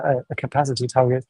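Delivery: normal talking voice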